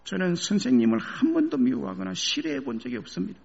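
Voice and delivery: high, pleading student; a student begging through tears; tearful plea. A narrator's reading. Student to professor, a tearful high plea. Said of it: "울면서".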